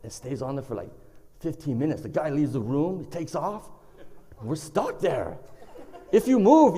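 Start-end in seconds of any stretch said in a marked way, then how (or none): none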